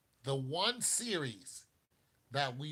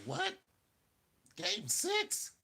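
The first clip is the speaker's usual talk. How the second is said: high, incredulous squeak; mock disbelief; much slower than usual. Voicing a whole nation stunned by game six, disbelieving. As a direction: blurt it as a baffled, high-pitched question. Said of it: "a whole nation that was just like".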